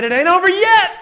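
high, loud and triumphant; hyped-up announcement. Retold or imitated. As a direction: whoop it up like a thrilled dad. Dad hypes up the date.

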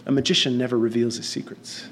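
low, soft, solemn magician; mock mysterious. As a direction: deliver it hushed, like a grand secret. Boy solemnly withholds the secret.

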